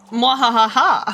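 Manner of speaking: hammy evil laugh; mad scientist cackle. Acted. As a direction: cackle like a cartoon villain. Villain laugh at squid.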